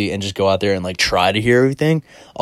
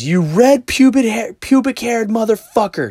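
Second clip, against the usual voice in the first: high, loud insult hurling; mock furious shriek; imitated screaming; 13 semitones higher, 6 dB louder than usual. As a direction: mimic an enraged woman screaming abuse. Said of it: "like, intense arguments".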